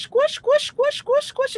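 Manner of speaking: very high, squeaky squish squish; delighted toy-squeaking chant; playful squish chant. Real, not acted. Squeezing a squishy toy in a store, squeaky high chant.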